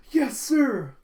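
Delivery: high, very loud eager cry; acted overjoyed acknowledgment at full volume; elated shout. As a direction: shout it like a thrilled, obedient son. Damien answering his father eagerly.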